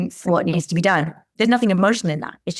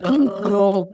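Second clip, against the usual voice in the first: shocked oh. Retold or imitated. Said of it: "that makes me want to make that noise".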